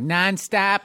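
"nonstop" with flattened Midwest "a" vowels, like "nahn-stap"; mock a wrong Boston accent that comes out Midwest; slow Midwest-accent "nonstop". Shown as a demonstration. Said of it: "It's like, no, that's Midwest".

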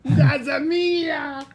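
high, slow wailing cry; mock pained wail. Friend joins the bit with a mock wail. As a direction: stretch it out like a hammy lament.

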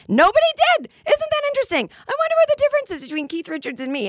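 high, loud sarcastic jab at the crowd. Real, not acted. Comic to audience, mock-innocent sarcastic high voice. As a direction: feign innocent surprise, dripping with irony.

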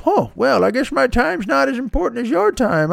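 high-pitched put-on grandpa voice, wounded; indignant grandfather. Grandfather in his brain takes offense at being kept waiting, huffy. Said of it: "there's a grandfather who just goes".

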